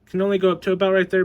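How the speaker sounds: ordinary delivery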